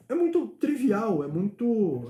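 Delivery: ordinary talk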